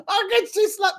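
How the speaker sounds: very high, loud mock yell. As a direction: ham up his outraged yell. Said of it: "he just yells".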